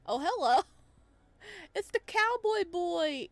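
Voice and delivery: usual speaking voice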